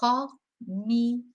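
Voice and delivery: comienza said slowly, syllable by syllable, ko-mi-en-za; slow syllable demo. Shown as a demonstration. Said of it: "Remember syllables to start with".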